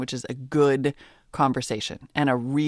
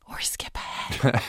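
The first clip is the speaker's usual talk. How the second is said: slow conspiratorial whisper; 4 semitones lower, 5 dB quieter, slower than usual. Real, not acted. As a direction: whisper it like a naughty secret tip. Joking aside about skipping chapters.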